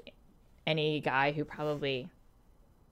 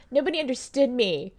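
mock tragic lament; 8 semitones higher, 4 dB louder than usual. Joking to a friend about her teen self, mock melodrama. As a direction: overplay the misunderstood artist. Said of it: "and nobody understood me".

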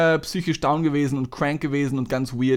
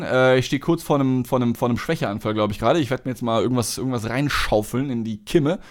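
ordinary delivery

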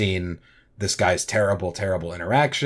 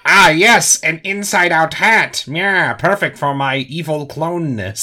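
evil villain voice; 7 semitones higher, 4 dB louder, slower than usual. Pretend. Playing his own evil clone.

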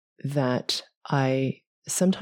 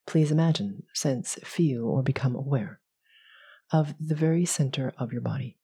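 guided meditation; 2 semitones higher than usual. Acted. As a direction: ease them through each breath. Leads a visualization.

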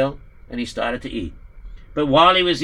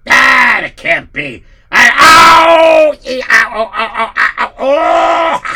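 agonized howling; 6 semitones higher, 10 dB louder than usual. Acted. Poritz in agony.

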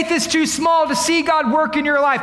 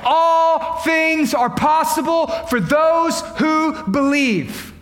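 proclaimed shout; slower than usual. Retold or imitated. Preacher proclaiming Jesus' words to the congregation, slow. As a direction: ring it out, slow and big. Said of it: "here's what he says".

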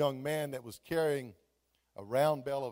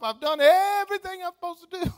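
exasperated protest; 13 semitones higher, 11 dB louder than usual. Retold or imitated. Pastor thinking about himself, exasperated protest. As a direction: cry it out in high-pitched exasperation.

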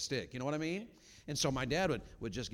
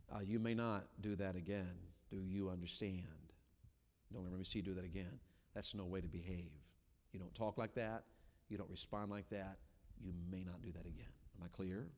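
low, controlled parental scolding; firm parent, softer and lower; calm reproof; 3 semitones lower, 5 dB quieter than usual. Shown as a demonstration. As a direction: lay down the rule without yelling. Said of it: "Be calm about it".

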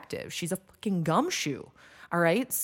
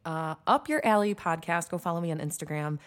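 ordinary talk, nothing marked

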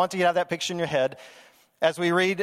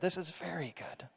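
much softer, almost breathed repeat; hushed echo; 13 dB quieter than usual. Retold or imitated. God admiring his creation, hushed and content. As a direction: let the repeat sink to a hush. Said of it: "he says".

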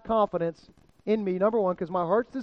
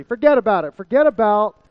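louder, hammed-up Jersey "Forget about it"; New Jersey accent; 6 dB louder than usual. Pastor riffing on the phrase to his congregation, mock New Jersey accent. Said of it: "Like you're straight from Jersey".

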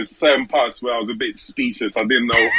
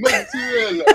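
mock Italian accent on Mozzarella, much higher and falling. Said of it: "I was mocking their accent".

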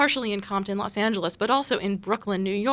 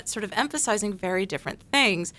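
ordinary delivery

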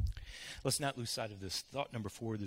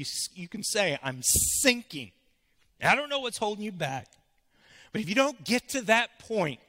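rising preacher's cry, louder and higher; preaching; 7 semitones higher, 10 dB louder than usual. Acted. Pastor pressing the congregation to admit failure, climbing preaching cry. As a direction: build the plea to the congregation. Preaching builds, repeated pleas.

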